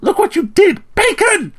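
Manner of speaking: put-on very high, indignant girl's cry; outraged cry. A narrator's reading. Athnal blames the narrator for her pig, outraged cry. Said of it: "cried Athnal".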